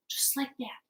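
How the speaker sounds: usual speaking voice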